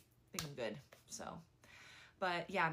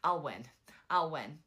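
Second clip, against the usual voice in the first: cocky I'll win; 8 dB louder than usual. Retold or imitated. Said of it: "And he's like".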